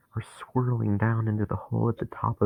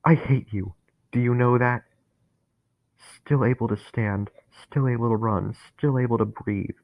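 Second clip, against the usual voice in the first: louder, higher, rabid hatred; growled with vitriol; 3 semitones higher, 3 dB louder than usual. Acted. Dennis snarling his hatred at Harry. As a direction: spit every word with venom. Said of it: "word growled and soaked with vitriol".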